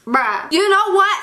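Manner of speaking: ordinary talk, nothing marked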